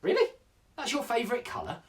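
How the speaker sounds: high-pitched disbelieving query; mock astonishment, pitched high; incredulous onlookers. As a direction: react as if you can't believe it. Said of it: "a lot of people look at me and go".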